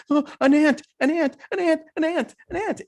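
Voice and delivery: high-pitched imitation of a panicking kid; mock freak-out. Small boy spots an ant on the couch, high mock panic. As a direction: squeal it like a small boy scared of a bug. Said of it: "you're freaking out over an ant".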